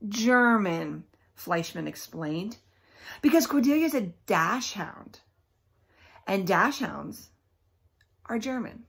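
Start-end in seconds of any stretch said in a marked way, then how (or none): none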